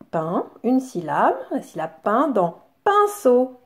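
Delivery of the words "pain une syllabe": normal talking voice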